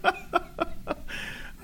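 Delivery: breathy high-pitched giggle. Real, not acted. Laughing at their own joke.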